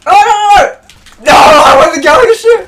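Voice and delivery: high, loud frantic shouting; desperate yells at the screen; panicking gamer. Real, not acted. Panicking during the match.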